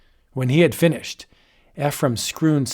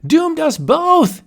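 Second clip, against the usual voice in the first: very high, louder, slow panicked cry; terrified croak; 13 semitones higher, 6 dB louder, slower than usual. Acted. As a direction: wail in terror. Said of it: "he croaked".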